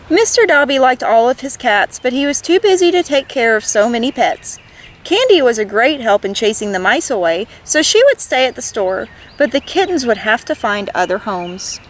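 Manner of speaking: put-on gentle read-aloud for children; lively picture-book reading; story narration. A narrator's reading. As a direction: tell it like bedtime storytime.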